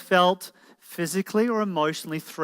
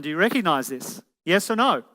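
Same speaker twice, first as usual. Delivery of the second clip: ordinary talk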